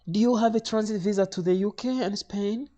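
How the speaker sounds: high-pitched official question; high, sustained clerk voice; airline lady. Retold or imitated.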